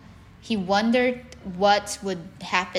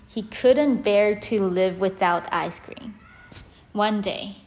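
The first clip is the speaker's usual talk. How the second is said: ordinary delivery, nothing marked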